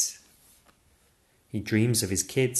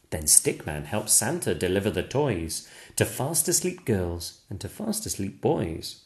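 ordinary delivery

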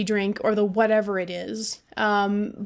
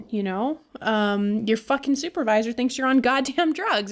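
usual speaking voice

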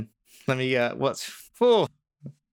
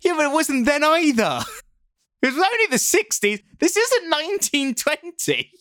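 much higher, louder protest; heated protest; 5 dB louder than usual. Real, not acted. Arguing with co-host about the town, high loud protest. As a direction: push back hard and laughing. Arguing the street wasn't rural.

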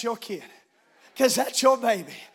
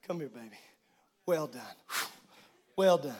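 very soft, low, fond; a father hushing praise to a child; whispered loving praise; tender welcome; 12 semitones lower, 10 dB quieter than usual. Retold or imitated. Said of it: "God welcoming the faithful".

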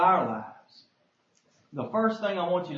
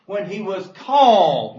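"called" loud and slow with a falling tune; slow down and hit "called"; stress on called; 7 dB louder, slower than usual. Shown as a demonstration. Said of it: "to emphasize the word again there".

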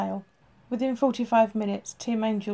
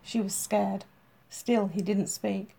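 usual speaking voice

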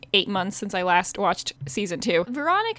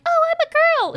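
squeaky high mock girl voice, much higher and louder; 5 dB louder, much faster than usual. A guy mocking girls in a high put-on voice. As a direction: play a guy faking a girl's voice. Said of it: "when guys are like".

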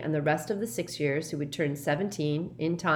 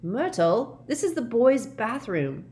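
higher, indignant objection; startled protest; 7 semitones higher, 4 dB louder, slower than usual. A narrator's reading. Ron to ghost in boys' bathroom, startled protest. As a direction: object in mild surprise.

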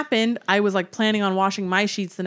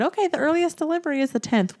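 store clerk voice; 9 semitones higher, faster than usual. Retold or imitated. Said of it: "they said".

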